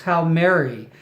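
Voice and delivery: ordinary delivery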